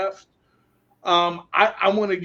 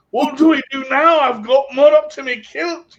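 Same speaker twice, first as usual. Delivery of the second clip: mock Scot; 7 semitones higher, 6 dB louder than usual. Scottish soldier stuck in mud.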